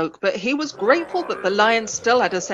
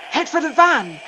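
loud urgent shout; 7 semitones higher, 5 dB louder than usual. A narrator's reading. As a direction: shout it while running. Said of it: "Rolf shouted".

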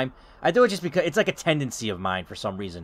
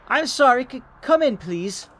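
high, louder stammering character voice; apologetic tenant; 10 semitones higher, 4 dB louder than usual. Acted. Tenant lets the caller in, high flustered apology.

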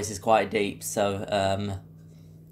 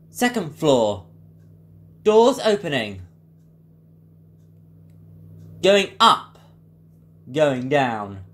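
new Ecodisc voice; slower than usual. Shown as a demonstration. Impressionist demos newer fourth gen Ecodisc, lift announcer voice. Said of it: "move on to the new Ecodisc voice now".

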